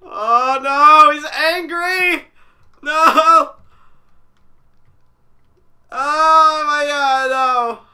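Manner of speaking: high, loud, drawn-out cries of alarm; panicked wail. Real, not acted. Player realizing the monster is angry, panicked wail. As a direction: wail in panic, stretching the vowels. Monster angered after taking page.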